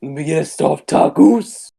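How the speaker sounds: hammed-up fast-food ordering bit; exaggerated Spanish-accent order; mock Taco Bell order.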